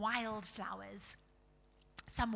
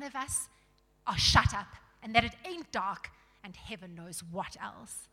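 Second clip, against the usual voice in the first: loud, jeering tirade; scornful dwarf; 9 dB louder than usual. Acted. Dwarf accusing the king of lying, scornful. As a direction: rant loudly as a grumpy dwarf.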